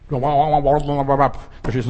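slow, slurred babbling; stretched-out garbled mumble imitating the deaf man; mock deaf man's babble. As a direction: garble "Verstehst du" like a man who can't speak. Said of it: "nur gebabbelt hat er".

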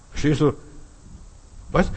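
usual speaking voice

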